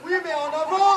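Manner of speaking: rallying cry in battle. Acted. Officer shouts orders. (ordres hurlés à l'assaut)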